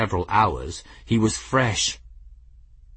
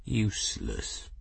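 soft, dangerous purr; hushed feline menace; very quiet purr; 11 dB quieter than usual. A narrator's reading. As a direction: purr the insult back very quietly. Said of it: "Raoul purred, very quietly".

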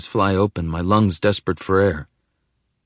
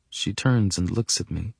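usual speaking voice

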